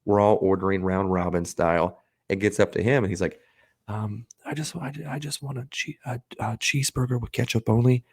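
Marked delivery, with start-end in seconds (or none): 3.9-8.0 timid mumble. Retold or imitated. Anxious kid quoted.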